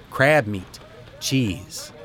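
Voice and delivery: ordinary delivery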